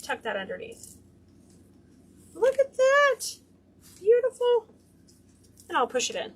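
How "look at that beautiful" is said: high, loud delighted coo over her work. Real, not acted. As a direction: gush over the ribbon like it's adorable. Admiring her finished ribbon.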